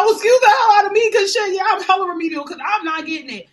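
high and loud, laughing through it; laughing mock indignation. About remedial math, a loud laughing mock outrage. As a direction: crack up while pretending to be offended. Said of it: "I was like".

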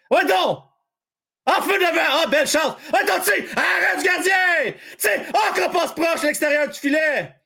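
high, shouted scoring chance; excited play-by-play. Real, not acted. Commentator reacting to a close chance at goal, highly excited. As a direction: cry out at every near miss.

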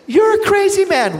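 imitating a stunned waitress; amazed girl. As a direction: squeal it half laughing.

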